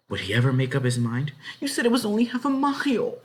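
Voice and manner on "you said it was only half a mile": put-on high girl's voice reminding; high reproachful girl. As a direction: nudge him, a bit pointed. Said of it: "she reminded him".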